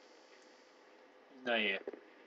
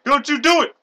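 mock warning; 7 semitones higher, 5 dB louder than usual. Plays negotiator pleading with hostage taker.

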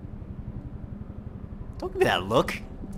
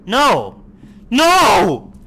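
loud, falling "no", growing higher; alarmed shout; 5 semitones higher, 9 dB louder than usual. Real, not acted. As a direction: shout it in refusal, louder the second time. Scared refusal in horror game.

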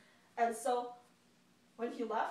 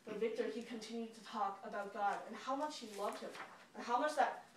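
usual speaking voice

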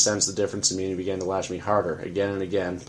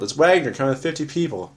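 higher and louder warning shout; urgent yell; 6 semitones higher, 5 dB louder than usual. Acted. Narrator warning Pete of the crowd, yelling. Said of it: "I yelled".

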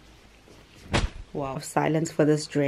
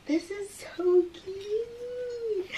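much higher, very slow, softer; drawn-out squeal; 4 dB quieter, much slower than usual. Real, not acted. Admiring her dress, high drawn-out squeal. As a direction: stretch every word in delight.

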